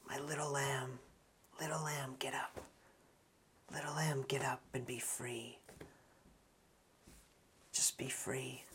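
near-whispered, gentle coaxing; very hushed tenderness. Acted. Jesus to the little girl lying dead, tender near-whisper. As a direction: murmur it as if gently waking a child.

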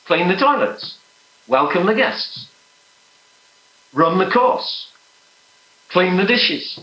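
high-pitched, emphatic preaching in measured phrases; impassioned preaching. Acted. Preacher declaring to the congregation he is a willing son, impassioned. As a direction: preach it with rising conviction, pausing between phrases.

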